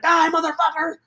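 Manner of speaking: high strained mock battle cry; movie hero yell. Hero yelling as the truck charges, high strained mock yell. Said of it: "and he's like".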